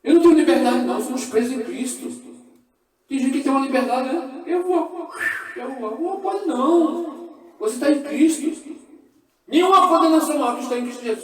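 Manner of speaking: loud, high preaching at full force; fiery preaching. Real, not acted. Preacher shouting the truth to the church. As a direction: proclaim it to the congregation.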